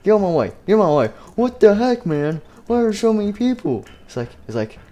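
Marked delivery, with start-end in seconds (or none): 0.0-3.8 high, loud angry yelling; angry Freddy. Acted. Freddy yelling at people blocking his way. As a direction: shove through the crowd yelling. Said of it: "He's like".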